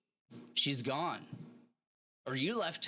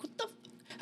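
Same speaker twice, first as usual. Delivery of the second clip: a breathless, whispered what the; mock shocked whisper; 8 dB quieter than usual. Soldier watching his buddy run, mock shocked whisper. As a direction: gasp it out in disbelief. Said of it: "I'd be like".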